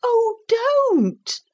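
put-on high, pleading child's cry; tearful boy's protest; almost weeping cry. A narrator's reading. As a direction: plead in a very high voice, close to tears. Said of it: "cried Philip, almost weeping".

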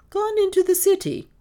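high, loud echoing question; surprised query. A narrator's reading. Mistress hearing Miss Dale has left, surprised. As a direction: repeat it in astonishment.

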